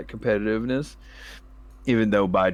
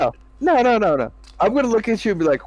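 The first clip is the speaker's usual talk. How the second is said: much higher, rapid no no no; emphatic refusal; 11 semitones higher, 3 dB louder than usual. Retold or imitated. Friend rejecting gentle advice, high and emphatic. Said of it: "It's like".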